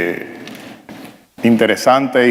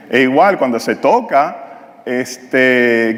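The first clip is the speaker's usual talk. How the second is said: normal talking voice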